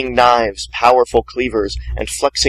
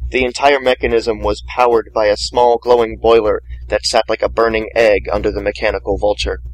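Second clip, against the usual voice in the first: usual speaking voice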